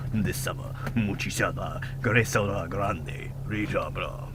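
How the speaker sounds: low, slow Spanish trailer announcer; mock Spanish accent, deep and slow. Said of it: "maybe he actually has a deep Spanish voice".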